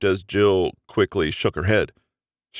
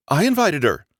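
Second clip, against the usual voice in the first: bright; 8 semitones higher than usual. Acted. Jill cheerfully admits inviting Margot, bright. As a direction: announce it cheerfully. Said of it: "Jill said brightly".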